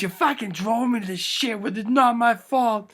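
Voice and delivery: high, whiny protest; upset blaming. Acted. Damon blaming bleeding Sean, upset and high. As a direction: push the blame away in a high, dragged-out voice.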